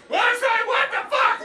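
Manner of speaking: very high, loud confused cry; groggy shock. Retold or imitated. Comic waking from surgery, shouting confused. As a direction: wake up baffled and yell.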